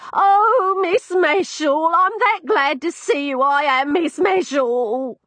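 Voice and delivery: high, loud, drawn-out quavering cry; cling to her and wail with relief; tearful old woman. Acted. Old landlady crying to the girl who found her.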